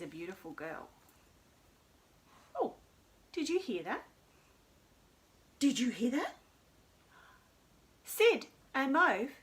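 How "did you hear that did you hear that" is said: ordinary talk, nothing marked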